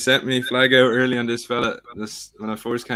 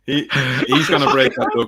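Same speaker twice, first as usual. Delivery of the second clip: laughing; 3 semitones higher, 5 dB louder than usual. Real, not acted. Laughing reply.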